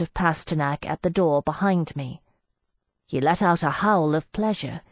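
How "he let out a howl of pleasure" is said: ordinary delivery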